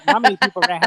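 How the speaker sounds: mocking repeat of a mumble; garbled mimic. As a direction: parrot the mumbled line back, mockingly. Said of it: "all I heard was".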